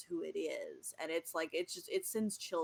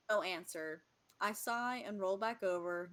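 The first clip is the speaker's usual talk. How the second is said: ordinary talk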